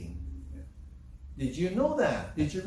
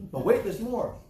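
higher, louder TV pitchman line; mock infomercial; 6 semitones higher, 3 dB louder than usual. Preacher joking like a TV salesman: mock infomercial pitch. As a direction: sell it like a late-night ad. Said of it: "That part is just me".

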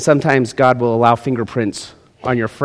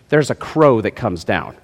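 usual speaking voice

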